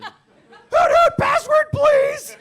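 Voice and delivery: very high, loud screech; Hootie character voice. Acted. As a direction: shout it in a cartoonish high-pitched voice. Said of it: "whatever Hootie's first line was".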